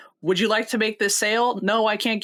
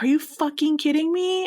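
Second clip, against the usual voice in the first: retold outrage; 7 semitones higher than usual. Retold outrage at manager caving.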